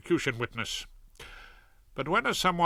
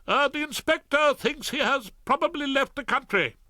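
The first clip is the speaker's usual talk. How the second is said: flustered Ballard; 13 semitones higher, 3 dB louder than usual. Acted. Ballard voiced.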